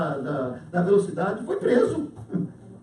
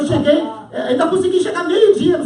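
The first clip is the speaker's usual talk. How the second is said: ordinary talk, nothing marked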